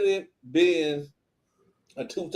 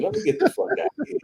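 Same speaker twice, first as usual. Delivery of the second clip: high, fast, rushed escape line; hurried exit; much faster than usual. Retold or imitated. Wanting out of the awkward call, rushed. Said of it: "I said".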